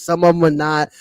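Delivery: ordinary talk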